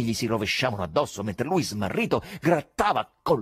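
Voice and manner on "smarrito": usual speaking voice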